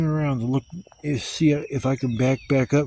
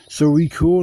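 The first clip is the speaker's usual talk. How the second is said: higher, louder hopeful question; sheepish owner; 3 semitones higher, 7 dB louder than usual. Retold or imitated. Dog owner hoping to smooth things over. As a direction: ask if it's all fine. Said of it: "he goes".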